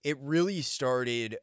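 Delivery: ordinary talk, nothing marked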